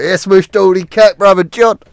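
loud, high slang-heavy shout; trailer-park impression. Imitating trailer-park talk for a mate, loud mock local. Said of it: "hear in the fucking trailer parks".

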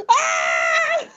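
very high mock death scream, cracking into laughter; shrieking mock scream through laughter; laughing parody of a falling man's scream; imitated scream. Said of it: "the fucking loudest scream".

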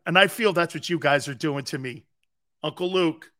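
ordinary talk, nothing marked

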